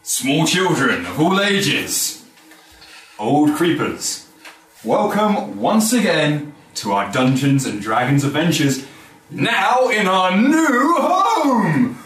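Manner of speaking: slow, grand showman announcement; ringmaster. Acted. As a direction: roll it out like a circus ringmaster. Grand opening announcement to players.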